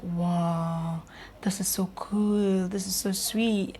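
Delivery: hushed, slow, admiring; awed whisper. Retold or imitated. Onlooker admiring a couple's hug, slow hushed whisper. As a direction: breathe it out in quiet wonder.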